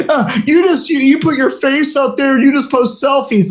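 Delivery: lightly voiced scolding rival; mocking quote. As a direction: mimic a nagging critic. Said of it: "He's like".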